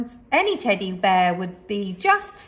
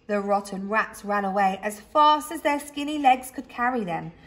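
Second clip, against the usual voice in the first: ordinary delivery, nothing marked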